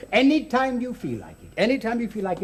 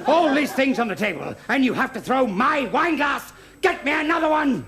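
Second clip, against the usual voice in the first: raised, scolding yell; indignant shouting; 5 semitones higher than usual. Acted. Acted quarrel over thrown glass.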